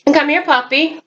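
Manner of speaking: high sing-song call to the dog. Real, not acted. Calling Isaac the dog over, high and coaxing.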